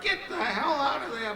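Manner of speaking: high, loud laughing yell; yelling at birds. Retold or imitated. Charlie honking at the crows on the cake, laughing yell. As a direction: shoo them off with a yell.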